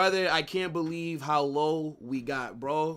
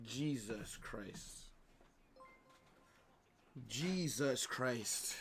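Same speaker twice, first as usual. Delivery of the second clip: despairing groan; 2 semitones lower, 9 dB quieter, much slower than usual. Real, not acted. Team losing, streamer in despair.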